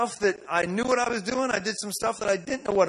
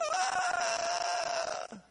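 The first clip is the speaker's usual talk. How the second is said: high shrieking scream, pitched way up; mock scream; 5 dB quieter than usual. Preacher imitates kids screaming during a deliverance, piercing shriek. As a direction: let out a sudden piercing shriek.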